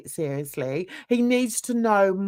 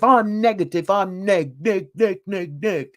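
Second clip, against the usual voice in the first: mocking chant of his own nickname, first word louder; a lightly voiced sneer at the online persona; Mister Negative impression; 4 dB louder than usual. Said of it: "who calls himself Mister Negative".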